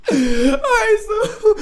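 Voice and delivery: high, laughing breathlessly. Real, not acted. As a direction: talk through a laughing fit. Laughing fit.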